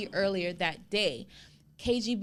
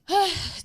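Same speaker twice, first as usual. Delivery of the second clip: loud high sigh; 11 semitones higher, 8 dB louder than usual. Real, not acted. Big sigh after laughing.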